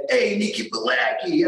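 a light, jokey Jersey Italian greeting, imitating the locals; loosely imitated New Jersey Italian accent. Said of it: "a lot of Italian New Jerseyans".

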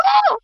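schoolgirl scream. Retold or imitated. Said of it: "I was like".

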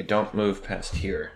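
ordinary talk

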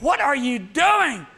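slow, high, loud outrage; outraged shout. Acted. Onlooker yelling at the dentist, slow and high-pitched. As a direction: shout it, scandalised.

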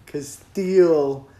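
put-on very slow drunk uncle drawl; slowed-down Trump. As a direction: stretch every word like half speed. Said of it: "Donald Trump played at half speed".

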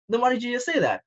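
high-pitched mock disbelief; incredulous comeback. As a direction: question them in exaggerated bafflement. Retells own baffled comeback to Disney.

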